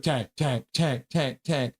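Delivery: a friend's low chant; imitating Calvin. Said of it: "Calvin's like".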